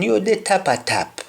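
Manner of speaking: "tapa tap" said high with falling end; proud Patois "tapa tap". Shown as a demonstration. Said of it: "leader of the state or nation".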